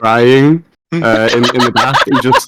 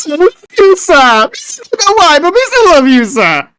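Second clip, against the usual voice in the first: Ribbit's voice. Acted. Ribbit running out soaking wet to Hunter, very high squeaky. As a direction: squeal it out in tiny devoted joy. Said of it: "he will run out soaking wet".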